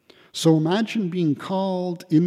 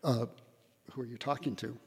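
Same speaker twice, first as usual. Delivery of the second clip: muttered under the breath, puzzled; a doubtful, softer who are you talking to; mock bewildered mumble; 3 semitones lower, 11 dB quieter, much faster than usual. Preacher voicing how people hear God's love, softly puzzled. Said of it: "that's how we all feel".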